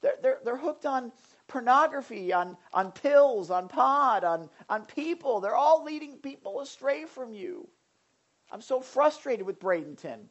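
high-pitched mock complaint; crying out. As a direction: plead in acted exasperation. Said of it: "you're crying out like".

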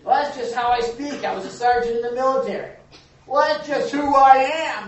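mock the lame excuse. Mocking people's excuses for cursing.

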